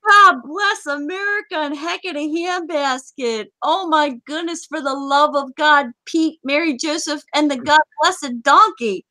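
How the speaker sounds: high-pitched string of mock oaths; comic exasperation. Host fed up with players who report bugs, comic exasperation. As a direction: pile up silly curses, exasperated.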